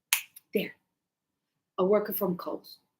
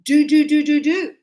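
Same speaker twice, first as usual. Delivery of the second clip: louder, hammering do do do; commanding do do do; 3 semitones higher, 7 dB louder than usual. Shown as a demonstration. Teacher showing how can sounds like orders, loud. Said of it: "it's like I'm saying".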